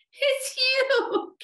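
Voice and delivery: very high, gleeful squeal; giggling. A narrator's reading. Eddie is tickled by the bear, giggling. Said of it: "giggled Eddie".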